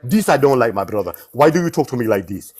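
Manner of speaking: Jamaican accent. Shown as a demonstration. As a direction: show off a Jamaican accent on request. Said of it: "I could be Jamaican".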